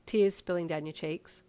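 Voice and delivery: ordinary delivery, nothing marked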